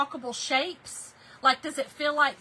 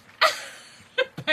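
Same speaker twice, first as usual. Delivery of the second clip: high squealing laugh; 13 semitones higher, 8 dB louder than usual. Real, not acted. Reacting to viewers' comments.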